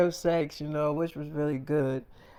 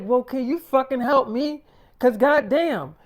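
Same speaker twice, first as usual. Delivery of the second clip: exasperated snap; 6 semitones higher, 4 dB louder than usual. Retold or imitated. Wife snaps for packing help.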